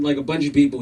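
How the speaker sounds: normal talking voice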